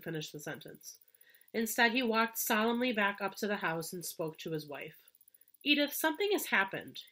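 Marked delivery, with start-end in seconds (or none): none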